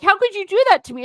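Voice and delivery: high, loud, fast mock wounded whine; mock outrage. Mocking the narcissist playing victim, high and fast. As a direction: ham up their fake indignation. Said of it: "then they're like".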